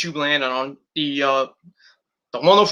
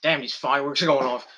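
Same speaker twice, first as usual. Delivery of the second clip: usual speaking voice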